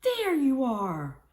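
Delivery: high, loud and slow, relieved to see her; mother hyena crying out as her daughter gallops up; cried out. A narrator's reading. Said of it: "cried Mama Hyena".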